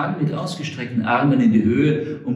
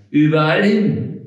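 louder, indignant echo of her words; irritated repeat; 5 semitones higher, 5 dB louder than usual. Acted. Frank repeats Rosalie's answer back to her, irritated. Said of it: "fragte der Hobble Frank gereizt".

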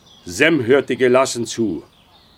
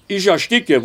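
choked, squeezed cry as if throttled; choking cry, pitched high; strangled gasp; 7 semitones higher than usual. Acted. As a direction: gasp it out like a hand is on your throat. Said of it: "Sie klang so, als hätte ihn jemand an der Kehle".

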